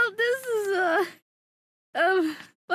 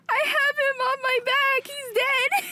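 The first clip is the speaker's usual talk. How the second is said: high, whiny mock lament; mock wailing; 11 semitones higher, slower than usual. Streamer re-enacting own reaction about Karu's friend, mock wailing. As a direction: wail it like a hammed-up mourner.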